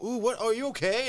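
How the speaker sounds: mock-worried gasp over a scar; concerned onlooker. Stranger noticing a scar on his arm, high mock concern. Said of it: "they're like".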